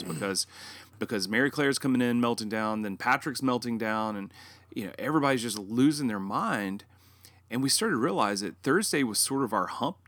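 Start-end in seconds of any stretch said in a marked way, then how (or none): none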